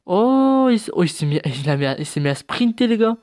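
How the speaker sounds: sudden high, loud outburst of excitement; excited. Real, not acted. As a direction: burst out, thrilled by the surprise. Creature suddenly starts sprinting. (très excité, voix qui monte)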